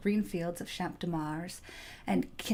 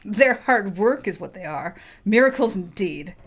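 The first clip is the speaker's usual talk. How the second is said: scoffing; 9 dB louder than usual. Acted. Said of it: "The artificer snorted".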